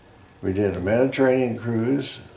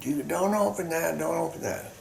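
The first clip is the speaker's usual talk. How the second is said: mock scream; 10 semitones higher, 4 dB quieter than usual. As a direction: mimic their yelling in a high, softened voice. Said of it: "they'd scream and yell".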